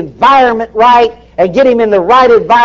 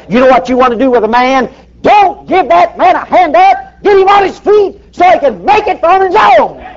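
preaching shout; 10 semitones higher than usual. Real, not acted. Preacher to congregation about handouts, building to a shout. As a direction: hammer it home at the top of your voice.

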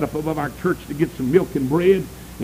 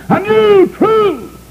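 scornful preacher cry; 8 dB louder, much slower than usual. Acted. Preacher mocking false teaching.

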